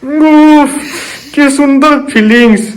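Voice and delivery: high, slow gasp from chilli heat; mouth burning from spicy food. Real, not acted. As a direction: wince through a burning mouth. Just ate chilli as punishment.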